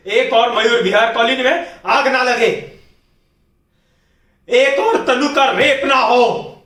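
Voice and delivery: anger rising from forceful vow to high, loud shouting; building rage. Acted. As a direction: build to an anguished, furious shout. Monologue builds into raging vow. (बढ़ते गुस्से में चिल्लाते हुए)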